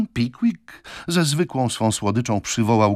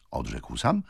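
plain reading voice

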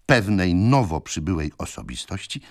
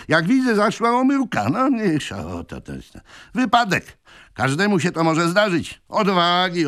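a fast-talking swell bossing everyone about; voluble green-coat stranger; 8 semitones higher than usual. Acted. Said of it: "wygłaszając z niepospolitą potoczystością". (wysoki, głośny, gadatliwy elegant)

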